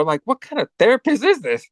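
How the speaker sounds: high-pitched, baffled disbelief; incredulous reaction. Retold or imitated. Host reacting to the slapping therapist, incredulous. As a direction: question it in rising mock disbelief. Host's own mock outraged reaction.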